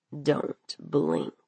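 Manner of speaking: low, soft villain voice; menacing warning. Acted. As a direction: warn her low and hushed. Knife-wielding man warns the girl.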